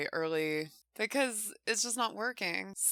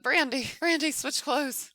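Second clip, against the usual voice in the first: higher and louder, urging her to hurry; urgent calling out; 8 semitones higher, 4 dB louder than usual. Real, not acted. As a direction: call out to save her from freezing. Urging the freezing Sim.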